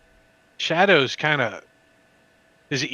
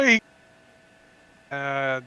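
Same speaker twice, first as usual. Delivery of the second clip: ordinary delivery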